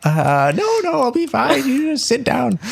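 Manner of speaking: very high falsetto, eager and cheerful; bubbly hostess. Acted. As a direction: chirp like a fussing hostess. Rose insisting guest sits.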